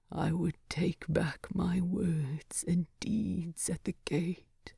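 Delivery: a dying king rasping his regret. Acted. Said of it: "Thorin had rasped".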